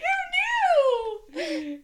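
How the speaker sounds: high, loud laughing squeal; hammed-up delighted shriek; giddy excitement. Host joking about her own fame, giddy.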